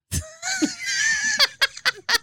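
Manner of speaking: very high shrieking laugh. Real, not acted. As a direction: burst into a squealing laugh. Big laugh.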